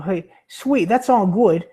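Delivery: ordinary talk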